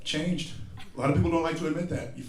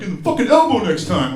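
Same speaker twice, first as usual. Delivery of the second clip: higher, louder scolding; scolding stranger; 6 semitones higher, 8 dB louder than usual. Retold or imitated. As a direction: tell him off like a self-appointed health cop. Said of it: "He's like".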